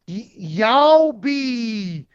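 slow, stretched nonsense noises like a broken robot; weird noises imitation. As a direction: mimic a malfunctioning wrestler's noises. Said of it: "that was him making noises".

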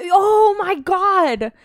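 loud, high, drawn-out exclamation; excited outburst. Real, not acted. Host breaking in with a bar story, excited outburst. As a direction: burst out with a story just remembered. Suddenly remembers a story to tell.

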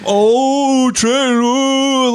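높고 크게 비웃듯 흉내 낸 놀란 탄성; high loud mocking gasp of disgust. Mocking coworker's reaction.

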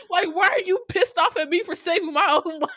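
high, loud, laughing indignation; laughing protest. Retold or imitated. Viewer arguing back for Rita, indignant and laughing. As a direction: protest loudly through the laughter.

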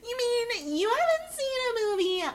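very high, hammed-up snob; film snob voice. Showing a friend their shared joke, film snob voice. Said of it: "That's our film snob voice".

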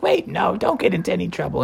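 quiet hurried take-back; mom taking it back. Acted. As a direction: hastily correct yourself, hushed. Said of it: "she was like".

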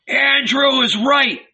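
high, drawn-out crowd yell. A narrator's reading. The hungry poor back Andrew, yelled. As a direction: yell agreement like a mob. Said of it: "they yelled".

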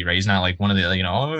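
ordinary delivery

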